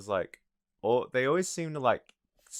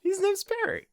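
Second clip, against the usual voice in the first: squeaky high-pitched voice; silly high voice; 3 dB louder than usual. Retold or imitated. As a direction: say it in a goofy falsetto. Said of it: "I was like".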